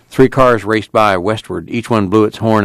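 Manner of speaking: ordinary delivery, nothing marked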